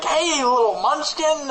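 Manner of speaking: put-on high cooing baby talk; sing-song high greeting to a cute creature; cooing at a pet. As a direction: coo it like greeting a puppy. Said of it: "Whenever I'm like".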